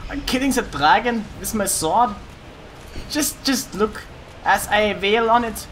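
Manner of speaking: put-on German accent. Killing the dragon with a sword, mock German accent. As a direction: narrate the fight as a mock German. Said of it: "I could do a German one".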